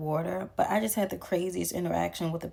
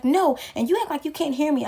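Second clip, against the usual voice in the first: indignant retort; 8 semitones higher, 3 dB louder than usual. Retold or imitated. Retold patient arguing on phone.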